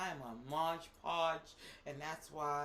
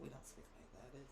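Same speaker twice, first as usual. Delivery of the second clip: ordinary talk, nothing marked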